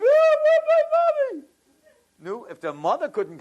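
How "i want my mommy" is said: put-on loud, very high child's wail; full-tilt acted tantrum, shrieked high; bawling little boy. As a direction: imitate a kid screaming through tears. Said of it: "The little boy starts crying".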